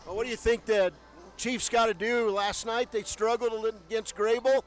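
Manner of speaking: ordinary delivery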